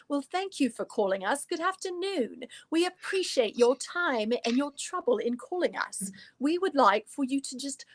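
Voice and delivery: put-on polite British shop greeting in a mild London accent; a hint of a posh London accent, courteous. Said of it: "How about Ann Taylor in London?".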